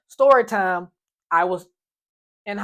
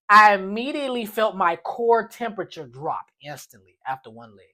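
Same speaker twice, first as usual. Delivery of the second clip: ordinary talk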